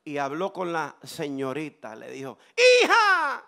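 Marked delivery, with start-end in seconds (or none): 2.5-3.4 booming high prophetic proclamation; full-voiced preacher voicing God to a daughter; the Lord speaking. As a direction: call it out slowly and loudly like a divine announcement. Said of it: "el Señor comenzó a hablar".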